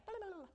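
high, loud nonsense babble; acted-out giddy blubbering; overjoyed babbling. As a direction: gibber wordlessly with excitement. Imitates own overjoyed babbling.

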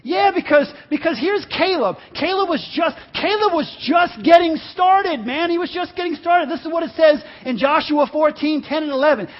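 high, loud fired-up preacher shouting; preaching at full force. Acted. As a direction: preach it with full fire to the congregation.

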